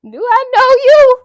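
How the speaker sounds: high, loud laughing Do I know you?; mock offended shout; hammed-up outrage. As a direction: overplay the shock with a laugh. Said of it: "So he ain't say it like that".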